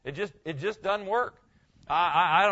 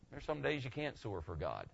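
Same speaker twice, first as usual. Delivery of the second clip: normal talking voice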